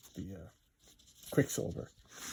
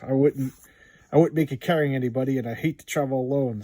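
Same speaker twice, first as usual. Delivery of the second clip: heroic declaration; 3 semitones higher, 9 dB louder than usual. Acted. Voicing a hero's comic dialogue.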